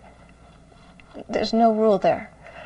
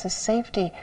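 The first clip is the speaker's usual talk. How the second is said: ordinary talk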